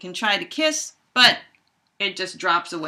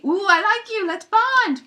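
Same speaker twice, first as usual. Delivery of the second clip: very high, louder cooing flirt; high, hammed-up romance; flirty bear voice; 12 semitones higher, 5 dB louder than usual. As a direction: coo it like a smitten cartoon bear.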